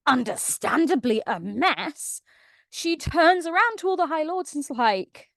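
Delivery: ordinary talk, nothing marked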